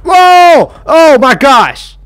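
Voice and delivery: sudden high yelp of fright, then a slow stretched gasp of words; jumped by a monster; startled yell. Real, not acted. Gamer jump-scared by Seek, startled yell.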